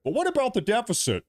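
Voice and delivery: high, whining mock question; mocking the critics who suddenly care about debt, high and sneering; mock whiny critic. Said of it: "mocking the critics' question".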